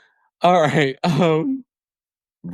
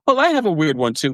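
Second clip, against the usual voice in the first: quick, higher, light imitation of a friend; mock Garcelle; 8 semitones higher, faster than usual. Mimicking Garcelle's quick comeback, lightly voiced and higher. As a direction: toss it back fast and breezy. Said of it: "Garcelle's just like".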